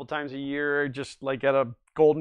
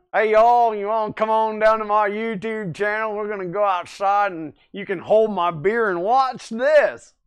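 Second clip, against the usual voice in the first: put-on country accent; 5 semitones higher, 7 dB louder than usual. Host redoes his country accent for chat, loud Southern. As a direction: play up a Southern good ol' boy accent. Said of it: "I was doing the country accent earlier".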